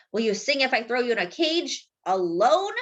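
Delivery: ordinary delivery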